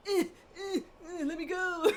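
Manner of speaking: whiny crying cat voice, a little slow; voicing the cat. Owner voicing her squirming cat's whiny protest. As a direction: whine like a pet begging to be put down.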